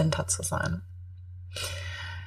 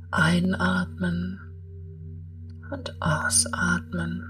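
guided meditation; 9 dB quieter than usual. Acted.

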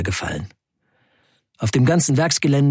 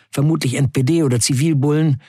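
ordinary talk, nothing marked

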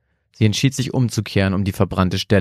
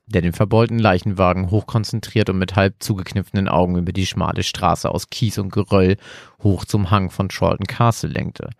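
ordinary delivery

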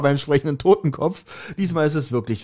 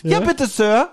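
eager Bob; 4 semitones higher, much slower than usual. Retold or imitated. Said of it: "wie Bob sagt".

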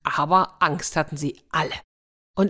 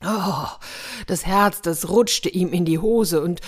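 usual speaking voice

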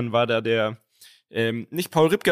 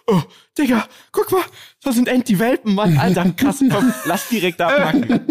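excited; 8 semitones higher, 4 dB louder than usual. Retold or imitated. Friend excited spotting the puppies.

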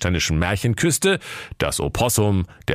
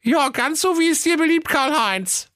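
high sugary wife's voice; dutiful ogre wife. Acted. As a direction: sweetly humor the husband. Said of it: "sprach die Renate Menschenfresser".

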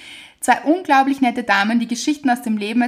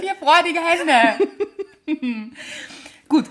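high, slow, laughing echo; 6 semitones higher, slower than usual. Real, not acted. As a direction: giggle it out stretched. Echoed laughing, stretched.

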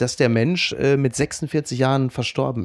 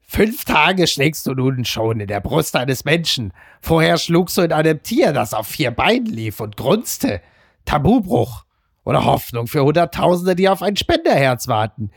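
Homer Simpson. Acted. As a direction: read the column aloud as a comic character, dragging each phrase. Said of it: "mit so einer Homer Simpson-Stimme liest". (getragen vorgelesen mit komischer Zeichentrickstimme)

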